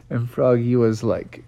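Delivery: usual speaking voice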